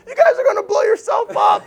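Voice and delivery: loud, high-pitched yell of warning; alarmed neighbor shouting. Retold or imitated. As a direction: yell it like a panicked onlooker.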